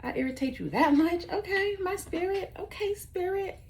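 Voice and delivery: high, sarcastic quoted comeback; a sarcastic jab at the dad; mocking retort. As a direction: toss it back with mock sweetness.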